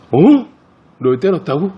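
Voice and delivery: a big brother stunned to hear his friend was beaten; shocked question. Retold or imitated. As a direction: react like you can't believe he got hit. Shocked reply to crying boy.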